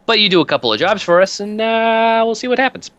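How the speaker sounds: high-pitched thug dangling a deal; wheedling mob enforcer. Acted.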